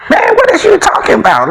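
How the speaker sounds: high, puzzled friend asking what he means; incredulous friend. Retold or imitated. Friend staring at him, baffled and high-pitched. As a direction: play the friend, baffled by the question. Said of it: "he looked at me like".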